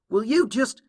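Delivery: high, loud, exasperated plea; exasperated demand. Acted. Applejack hurrying in front of the filly, exasperated demand. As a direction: raise your voice to make them listen.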